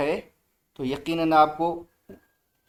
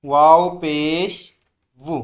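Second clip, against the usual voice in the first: slow, loud drilled syllable; teacher's loud slow recitation; 5 dB louder, slower than usual. Shown as a demonstration. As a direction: call it out loud for the class. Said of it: "ज़ोर से बोलें".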